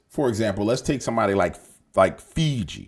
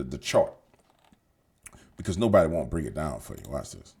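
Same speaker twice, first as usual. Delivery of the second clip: ordinary delivery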